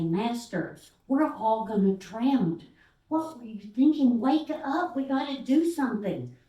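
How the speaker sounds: loud, high, alarmed shouting to wake him; panicked plea. Acted. Panicked disciples waking Jesus, loud and alarmed. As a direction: disciples shouting over the storm.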